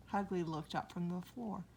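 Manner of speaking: ordinary delivery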